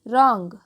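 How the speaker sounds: रॉन्ग starting straight on R, "rong"; silent W. Shown as a demonstration. Teacher showing that W is silent, "rong" for रॉन्ग. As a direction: say रॉन्ग firmly with no W sound. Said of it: "डब्ल्यू जो है वो silent है".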